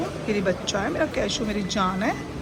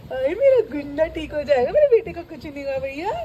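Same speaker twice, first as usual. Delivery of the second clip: ordinary talk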